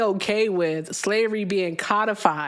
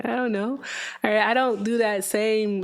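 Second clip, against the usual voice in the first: normal talking voice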